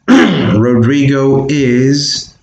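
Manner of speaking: "Rodrigo is" said very slowly; slow, deliberate teacher model. Shown as a demonstration. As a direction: draw out "Rodrigo is" for the class. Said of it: "You would say Rodrigo is".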